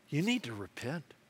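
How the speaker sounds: hushed, earnest admonition; quiet appeal. Acted. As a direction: urge it gently and soberly. Jesus's appeal, against old-school take.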